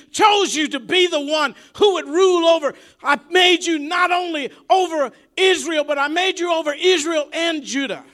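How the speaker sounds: high, loud shouted rebuke from God; booming rebuke. Retold or imitated. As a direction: thunder it down at the sinful king. Preacher voices God rebuking David.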